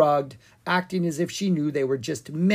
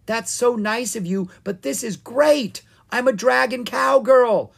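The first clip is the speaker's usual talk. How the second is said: high-pitched gleeful shout, building louder; excited yell; 10 semitones higher, 6 dB louder than usual. A narrator's reading. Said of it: "Ella yelled".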